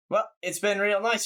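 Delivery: put-on cheerful goodbye in a New Zealand accent. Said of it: "so it just became a New Zealand accent".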